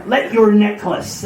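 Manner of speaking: slow Cornish sea-dog; Cornish pirate accent. Acted. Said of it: "it sounds very Cornish now".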